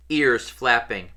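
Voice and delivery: plain reading voice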